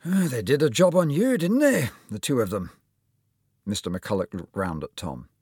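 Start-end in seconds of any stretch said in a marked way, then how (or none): 0.0-2.7 higher, louder Scottish surgeon; Scottish accent. Acted. Surgeon looking at the x-rays, Scottish accent. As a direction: exclaim over the damage in a Scottish lilt. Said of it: "with just a hint of a Scottish accent".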